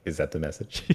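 soft, offhand receptionist brushing him off; sassy receptionist. Retold or imitated. Receptionist softly stonewalling Dan's urgent call, mildly sassy. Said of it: "the most sassiest, uh, receptionist".

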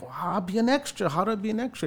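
high, eager questions; eager plea. Retold or imitated. Asking the dreadlocked extra, high and eager. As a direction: jump at the chance.

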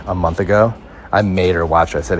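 ordinary talk

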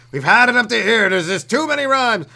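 high, loud hammed-up protest; exasperated shout. Fed-up crowd protesting his rhymes, exasperated shout. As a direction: holler like the whole crowd has had enough.

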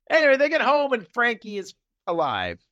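ordinary delivery

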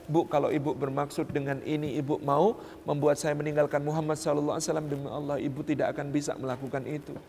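soft, subdued earnest appeal; retold teary-eyed plea. Devoted son appeals to his mother on hunger strike, teary-eyed pleading. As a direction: plead gently, as if holding back tears. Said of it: "mengatakan dengan mata berkaca-kaca".

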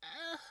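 high-pitched short mock moan; imitated moan. Storyteller retrying his classmate's moan, imitated moan. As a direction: let out a high put-on moan. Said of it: "can't even do it without even breaking".

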